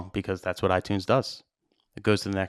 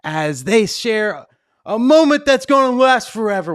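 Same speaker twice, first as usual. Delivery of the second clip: the DJ sets the moment for the couple's first dance; wedding MC announcer; 8 semitones higher, 3 dB louder than usual. Retold or imitated. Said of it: "I set them, set the moment".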